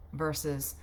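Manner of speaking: ordinary talk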